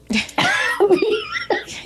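a high burst of real laughter. Real, not acted. Friend laughing at the fight recap, high laugh. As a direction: crack up at the memory.

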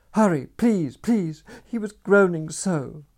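high, loud desperate urging; frantic pleading. A narrator's reading. Girl urging the stranger up the steps, high and desperate. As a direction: beg him to hurry, almost crying.

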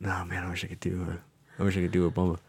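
ordinary talk

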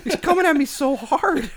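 high, loud laughing complaint; cracking up while complaining. Real, not acted. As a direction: blurt it out through big laughter. Laughing at interviewer.

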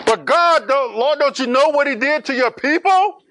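high, loud disbelieving plea; alarmed protest. Acted. Ananias protests to God.

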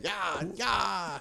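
put-on [name] ad-lib. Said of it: "what's the [name]?".

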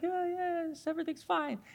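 a high, soft, nothing-to-see-here dog; calm dog voice. Retold or imitated. Pastor voicing the dog lazing in the yard, high and soft. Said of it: "just sit there like".

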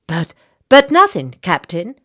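loud, high interruption; cutting in. Acted. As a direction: cut the captain off. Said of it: "she interrupted".

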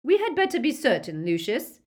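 cold, clear warning to her husband; cold, clear Narcissa. A narrator's reading. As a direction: pitch it up, crisp and cold. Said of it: "in her cold, clear voice".

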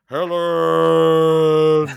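long, high, drawn-out bellow; mock anguished shout. Joking about a reconstructed Skywalker, mock anguished shout. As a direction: wail the name loud and long, hammed-up. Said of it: "and just shout".

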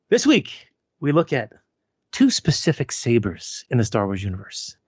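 grand, theatrical announcer delivery; show announcer. Pretend. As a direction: present the contender like a title fight. Announces the two contenders.

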